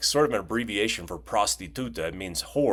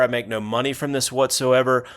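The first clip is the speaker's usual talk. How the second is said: ordinary delivery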